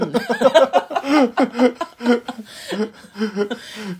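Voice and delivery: big helpless laugh; loud laugh. Real, not acted. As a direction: burst out laughing. Hearty laugh at a joke.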